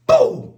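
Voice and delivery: high, loud "boom" for a knockout; explosive mimicked impact; imitated punch sound. Imitating a knockout blow in story.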